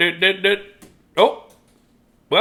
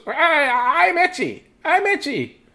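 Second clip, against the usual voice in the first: high, whiny talking nose; whiny itchy nose; slower than usual. Retold or imitated. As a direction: squeak it out like a nagging nose. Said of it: "your nose goes".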